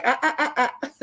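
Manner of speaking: playful warning ah ah ah; scolding ah ah. Retold or imitated. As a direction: wag a finger with your voice. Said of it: "He's like".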